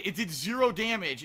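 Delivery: ordinary delivery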